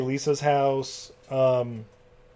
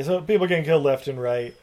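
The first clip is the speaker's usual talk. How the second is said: normal talking voice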